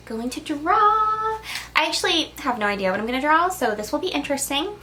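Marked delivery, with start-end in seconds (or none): none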